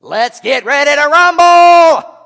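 huge, high, drawn-out announcer shout; wrestling ring announcer. Acted. Preacher kicking off his mock wrestling match, ring announcer's roar. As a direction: bellow it to the arena, holding the last word long. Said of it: "wrestling. Um, I occasionally do. So let me set it up".

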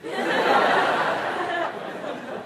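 airy unvoiced chuckle; breathy laugh. Real, not acted. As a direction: laugh at the glowing-face joke. Laughs at his own joke.

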